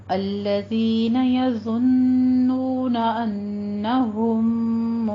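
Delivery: slow melodic tajweed recitation; Quran recitation. Shown as a demonstration. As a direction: chant slowly, held vowels. Teacher recites verse 46.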